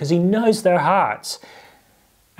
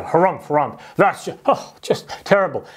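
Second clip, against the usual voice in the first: mock harrumphing outrage; hammed-up huffy muttering of the offended; indignant; 2 semitones higher than usual. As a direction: splutter with put-on disapproval. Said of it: "they began to be indignant".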